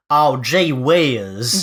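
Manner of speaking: "Oh, gee whiz" stretched very slow with extra vowels; syrupy Southern drawl. Shown as a demonstration. Showing the Southern drawl, slow syrupy "Oh, gee whiz". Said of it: "every word has, like, some syrup in it".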